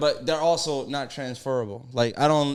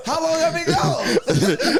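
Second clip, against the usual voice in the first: high, drawn-out comic wail; laughing wail of disbelief; mock alarmed disbelief; 12 semitones higher, 4 dB louder, much slower than usual. Lost kid hearing his name over the intercom, mock alarmed wail.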